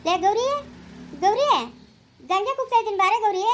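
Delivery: high, forced sweetness; forced smiling call. Acted. Forced by mother-in-law, Ambuji calls Gauri in high fake sweetness. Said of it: "ಹಿಂಗಲ್ಲ, ನಗು ನಗ್ತಾ ಕೂಗು".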